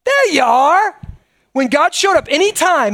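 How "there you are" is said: mock casual greeting. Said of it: "people didn't go".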